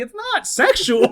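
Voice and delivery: high, slow mocking echo. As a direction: parrot him back high and slow. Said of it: "I'm like".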